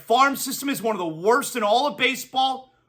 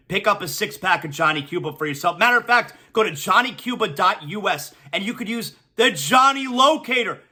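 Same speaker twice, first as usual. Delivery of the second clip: ordinary delivery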